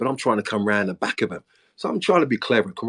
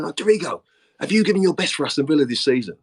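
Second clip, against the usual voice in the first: higher-pitched challenge to a player; imitated confrontation; 7 semitones higher, 3 dB louder than usual. Manager confronts Dorigo before the squad, imitated challenge. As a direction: mimic a manager putting someone on the spot.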